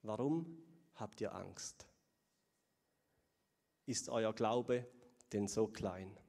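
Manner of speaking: Jesus calmly asking his frightened friends; gentle question. Acted. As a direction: ask kindly, no reproach. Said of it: "und dann sagt er".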